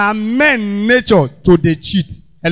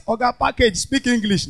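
ordinary delivery

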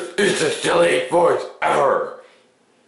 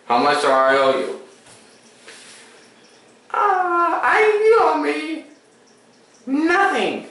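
squeaky toy plumber; 12 semitones higher than usual. Pretend. Plumber character keeps talking.

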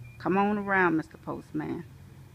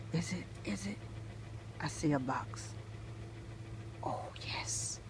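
whisper; 4 semitones lower, 12 dB quieter than usual. Real, not acted. Spots a box in mailbox.

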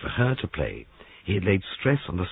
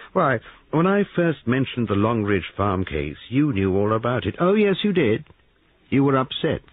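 pressing detective; 3 semitones higher, 4 dB louder than usual. Acted. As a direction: lay out the evidence like closing a trap.

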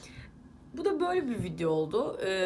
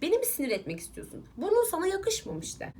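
usual speaking voice

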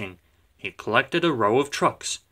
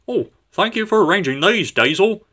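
higher, quick, breezy thanks; innocent engine; 8 semitones higher, 3 dB louder than usual. A narrator's reading. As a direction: thank the rival with mock innocence. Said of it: "said Duck innocently".

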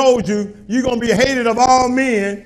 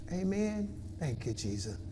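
much softer and lower, slow; soft praise; 6 semitones lower, 9 dB quieter, slower than usual. Acted. Pastor to God, soft murmured praise.

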